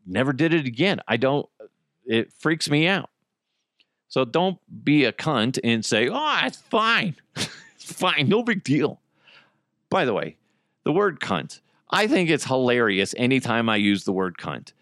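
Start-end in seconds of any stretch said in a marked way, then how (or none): 6.1-9.0 mock the careless driver's shrug; mocking dismissal. Mocking people who shrug off drunk driving, high sing-song.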